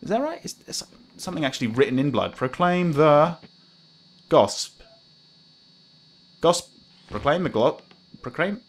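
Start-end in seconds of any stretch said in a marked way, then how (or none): 2.3-8.6 halting reading. Real, not acted. Stumbles reading a blood message.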